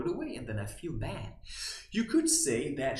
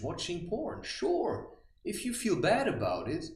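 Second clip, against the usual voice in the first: usual speaking voice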